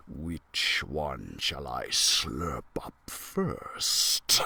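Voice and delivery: deep, slow, far-off dragon rumble; a hungry dragon gloating over its prey; greedy dragon. Acted. Dragon eyeing the trapped mice, deep greedy gloat.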